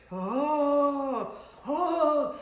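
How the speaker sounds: hooted "oh" howl, mocking. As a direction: moan like an ancient man or cartoon ghost. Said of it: "That sounds like a ninety-seven-year-old man".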